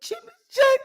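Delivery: very high squawky wail; chicken-like speech. Acted. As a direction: cluck the words out like a distressed hen. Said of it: "speaking like a chicken and then speaking like herself".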